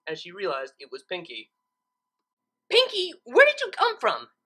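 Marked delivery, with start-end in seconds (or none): 2.7-4.3 high, loud, flustered question; startled Twilight. Acted. Twilight, heart pounding, asks Pinkie where she came from. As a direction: still shaken, demand an answer. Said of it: "asked Twilight, while her heart was still pounding".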